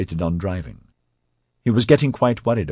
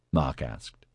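ordinary delivery

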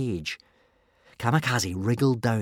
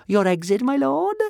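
grinning; 14 semitones higher, 3 dB louder than usual. A narrator's reading. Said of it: "grinned Kamikaze".

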